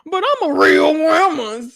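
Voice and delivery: high, loud, slow whiny sob; mock crying plea. The woman pleading to the intruders, crying high and slow. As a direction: whimper it like a hammed-up crybaby.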